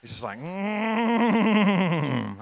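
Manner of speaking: long drawn-out cringing "mm" with a laugh; cringing groan. Real, not acted. Preacher groaning at hearing oil said wrong, cringing. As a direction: groan through a laugh as if it hurts to hear. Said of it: "that pegs my cringe meter".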